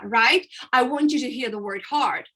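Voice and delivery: ordinary talk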